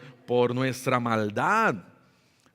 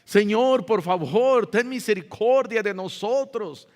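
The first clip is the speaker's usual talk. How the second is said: raised, louder imploring cry; heartfelt pleading prayer; 7 semitones higher, 4 dB louder than usual. Acted. Preacher voices Esdras begging God for mercy, heartfelt plea. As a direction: beg earnestly for mercy, voice lifted. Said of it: "oraba Esdras de todo corazón".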